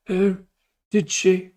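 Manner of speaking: high, spaced-out mock reply; out-of-it old king. Sleepy king woken with grave news, dazed and oblivious. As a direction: answer like a doddery king off in a daze. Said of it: "Completely out of it, high on mushrooms".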